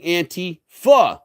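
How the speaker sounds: Antifa slowed into "AN-tee FA"; corrected pronunciation. Shown as a demonstration. Speaker shows what listeners miss, Antifa as slow AN-tee FA. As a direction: separate anti from fa in Antifa. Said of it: "causes you to mispronounce it".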